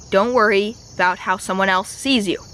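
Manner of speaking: normal talking voice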